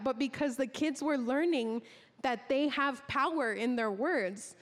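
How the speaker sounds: ordinary delivery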